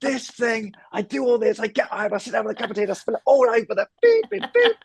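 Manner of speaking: hammed-up, very high-pitched comic meltdown; end-of-the-world rant. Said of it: "spill their tea and it would be like the end of the world".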